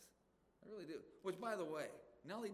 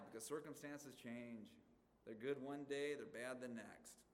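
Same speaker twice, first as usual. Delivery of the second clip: ordinary talk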